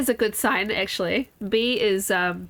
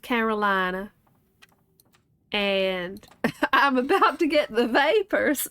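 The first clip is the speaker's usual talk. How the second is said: put-on Southern accent. Confessing visits to the South, hammed-up Southern accent. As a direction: play up a fluttery Southern belle. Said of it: "It's the only accent I know how to do".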